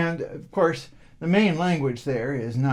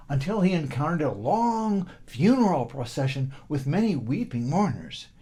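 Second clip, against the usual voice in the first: usual speaking voice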